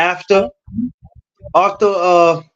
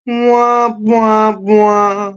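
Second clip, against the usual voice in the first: muffled wah wah talk; 7 semitones higher, much slower than usual. Retold or imitated. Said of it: "that's my joint right there".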